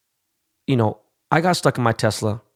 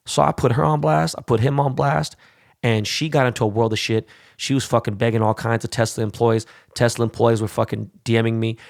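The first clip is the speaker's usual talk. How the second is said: ordinary talk, nothing marked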